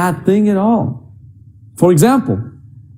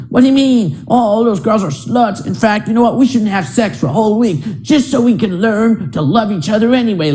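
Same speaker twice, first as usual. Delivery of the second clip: put-on prudish girlfriend; 6 semitones higher than usual.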